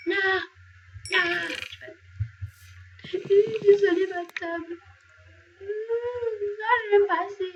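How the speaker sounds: high, whiny fake sobbing, clearly hammed-up; fake crying. Streamer to viewers over her table, hammed-up fake sobs. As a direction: pretend to cry over the broken table, overdone. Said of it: "Je pleure".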